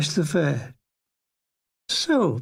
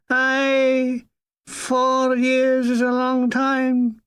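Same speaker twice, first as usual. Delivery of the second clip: high, slow Scottish lilt on four years; Scottish chaplain; 6 semitones higher, much slower than usual. Retold or imitated. The old army chaplain telling the narrator, in a slow high Scottish voice. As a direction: draw it out like the old Dundee padre.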